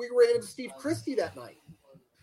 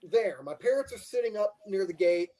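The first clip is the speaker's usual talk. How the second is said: usual speaking voice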